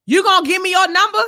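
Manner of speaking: loud, high pushy demand; imitating a brazen shouted come-on; mimicked yell. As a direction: call it out like a pursuer at a car window. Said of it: "you yell me at me".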